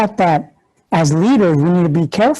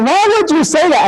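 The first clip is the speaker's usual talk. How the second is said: put-on much higher, upset child's voice; a niece upset by her uncle's joke, high hurt voice; hurt little girl; 10 semitones higher than usual. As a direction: ask as a wounded three-year-old about to cry. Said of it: "She went".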